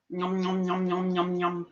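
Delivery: soft munching nom noms; munch quietly while eating; eating noises. Real, not acted. Eating chocolate, eating sounds.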